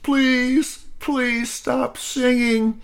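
very high slow whimpering please; crying plea. Acted. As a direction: beg through tears. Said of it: "The leader was crying".

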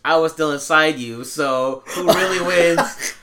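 loud, smug, drawn-out comeback; petty comeback. Retold or imitated. Fan's comeback to a taunting ex, loud and slow. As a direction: savour the burn, stretching the last words. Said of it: "so tempted to be like".

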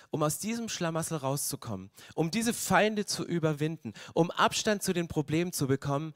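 normal talking voice

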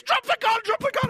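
loud high yelled command; police yelling. Retold or imitated. Riot police to the man holding the BB gun, loud high yell. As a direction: scream it like a startled armed cop.

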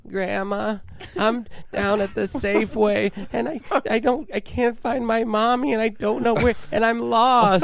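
put-on high, small voice of a lost child; lost little kid. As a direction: play a scared little boy calling grandma. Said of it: "And I was like".

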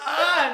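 high, loud yelp with a laugh; retold yelp of pain. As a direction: imitate a sharp cry of pain. Retold yelp touching sore spot.

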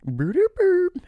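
very high, loud creature hoots; Grimace noises. Acted. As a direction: blurt high cartoon-monster syllables. Voicing Grimace's nonsense call.

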